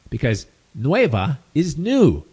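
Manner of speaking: ordinary delivery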